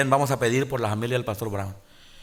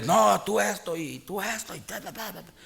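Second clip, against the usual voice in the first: imitating a big man yelling, much higher; mock angry insult; 7 semitones higher than usual. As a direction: rattle off the abuse in a mock rant. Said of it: "le empezó a insultar al pastor".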